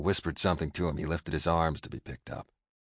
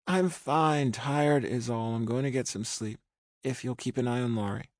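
put-on weary young woman; 7 semitones higher than usual. A narrator's reading. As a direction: sound worn out, brushing it off. Rachel voiced by narrator.

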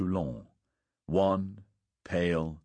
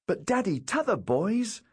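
put-on whining small boy; 14 semitones higher than usual. A narrator's reading. Son pleading with his father, childish whine. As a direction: plead like a thwarted four-year-old. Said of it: "Hugh whined".

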